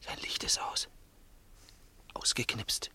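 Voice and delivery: tense whisper in the dark; hushed, nervous whisper. Acted. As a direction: whisper it so nobody hears. Sneaking up at night, whispering.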